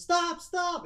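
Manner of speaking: high, loud cry to stop; urgent shout. Acted. Vaya walks in on a fight, shouting high and urgent.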